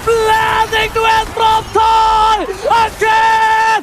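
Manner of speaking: full-force esports scream; hype shout. Real, not acted. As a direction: scream the kills, drawn out. Caster calls the triple kill. (teriakan komentator penuh)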